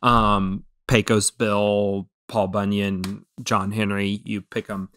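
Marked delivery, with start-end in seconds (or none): none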